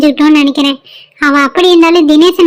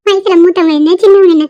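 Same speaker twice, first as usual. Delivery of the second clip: ordinary delivery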